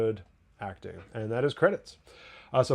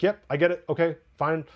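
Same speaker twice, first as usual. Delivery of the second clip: higher, louder clipped yep; curt forced acceptance; 5 semitones higher, 4 dB louder than usual. Retold or imitated. Host imitating Mike on the phone, tight agreement. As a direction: bite back the anger, agree tightly. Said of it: "He's like".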